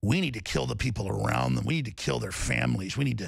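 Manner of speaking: ooze it like a sinister bureaucrat; slimy official. Retold or imitated. Mimicking the ex-CIA deputy director, low and slimy. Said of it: "he's so slimy".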